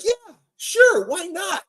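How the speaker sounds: high shouted sarcastic yeah sure; mock sarcastic agreement. To Brett, mocking his deal with a high sarcastic shout. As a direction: toss off a fake agreement, way up high.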